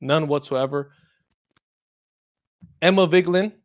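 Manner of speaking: ordinary talk, nothing marked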